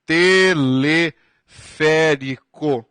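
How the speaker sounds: Teleférico drawn out syllable by syllable; dictating while writing; slow while writing. Shown as a demonstration. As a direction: say Teleférico slowly as you write it on the board. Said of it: "Vou escrever aqui, ó".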